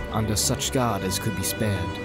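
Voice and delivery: ordinary delivery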